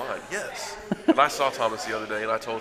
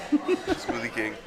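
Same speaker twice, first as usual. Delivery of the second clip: laughing; much slower than usual. Real, not acted. Laughing joke about smoothie dessert.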